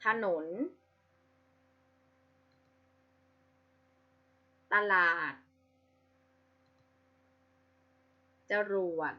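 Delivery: ถนน ตลาด จรวด, each with a short half "a" in the first syllable; two-syllable reading. Shown as a demonstration. Teacher showing pupils two-syllable ห นำ words, short first syllable. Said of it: "จะออกเสียงอะกึ่งเสียง ที่พยางค์หน้า".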